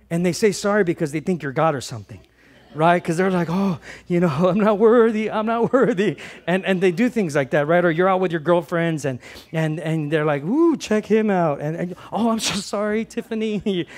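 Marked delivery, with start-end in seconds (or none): 3.5-6.2 high, laughing mock humility; mock grovelling. Mimicking people abasing themselves before believer. 10.4-11.6 high, drawn-out admiring coo; mock ogling girlfriend. Girlfriend out with friends, mock admiring. 12.1-13.8 high-pitched mock apology; mock apologetic girlfriend.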